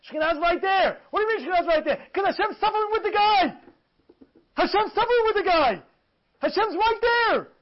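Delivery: high-pitched, insistent preaching; fervent sermon cry; impassioned preaching. Acted. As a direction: preach it with rising urgency, stretching the last words. Preaches at full force.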